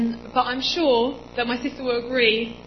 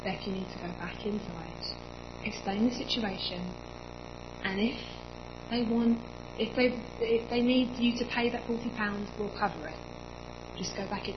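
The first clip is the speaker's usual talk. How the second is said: calm mum; 2 semitones lower, 9 dB quieter than usual. Retold or imitated. Mum calming her daughter on the phone, soft and steady. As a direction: settle a panicking daughter gently. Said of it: "And she said".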